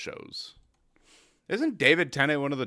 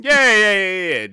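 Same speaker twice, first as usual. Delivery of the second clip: loud mock agreement; 7 semitones higher, 10 dB louder than usual. Real, not acted. Mock agreeing about forgiveness.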